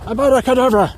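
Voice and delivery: high, loud wizard incantation; magic spell shout. Pretend. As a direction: cast the spell with a big shout. Magic spell shouted over ice hole.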